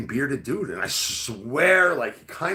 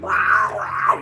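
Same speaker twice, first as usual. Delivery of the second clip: high, loud yowling cat; a cat yelling at him; 8 semitones higher, 6 dB louder than usual. Retold or imitated. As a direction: yowl like an angry cat. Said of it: "yelling at me".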